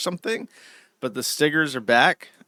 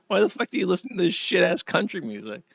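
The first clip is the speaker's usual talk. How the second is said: mocking retort; 6 semitones higher, faster than usual. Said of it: "I know, it's like".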